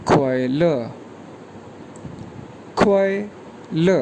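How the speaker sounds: ख्वाइल said slowly with a falling tone; model pronunciation. Shown as a demonstration. Teacher repeating ख्वाइल for students, slow. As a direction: drill ख्वाइल, drawn out and falling. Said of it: "ये pronounce किया जाएगा ख्वाइल".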